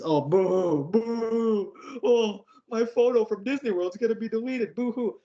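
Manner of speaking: high, fake-crying boo hoo; high mock crying over lost photos; mock sobbing. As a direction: whine like a crybaby, mocking. Mocking a kid's crying.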